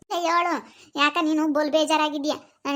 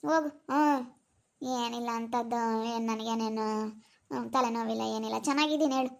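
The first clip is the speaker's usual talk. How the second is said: low, soft, downcast denial; dejected; 4 semitones lower, 9 dB quieter than usual. Acted. Insisting to a worried relative that nothing is wrong, dejected. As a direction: brush off concern in a subdued, low voice. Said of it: "ನೀನ್ ಯಾಕೋ ಬೇಜಾರಾಗಿದ್ದಿ".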